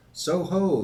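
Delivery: loud, high guard's holler; roaring challenge. A narrator's reading. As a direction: roar it out to a distant rider. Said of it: "as loud as he could roar".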